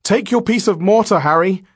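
high, loud, panicked plea; urgent, high-pitched horrified voice; frightened gasp. Acted. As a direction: gasp it out in terror. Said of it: "gasped Grant".

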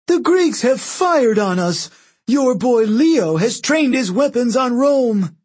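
high, slow, strident accusation; outraged shout. A narrator's reading. Octavian accuses the Greeks of firing, outraged shouting. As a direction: shout the accusation to the whole crowd.

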